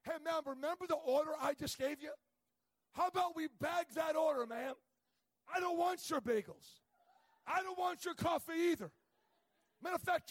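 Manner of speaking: loud, high ranting complaint; imagined angry rant. Retold or imitated. Preacher acting out what he wanted to tell the cashier, loud mock rant. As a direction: let the cashier have it, half for laughs. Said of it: "what I wanted to say is".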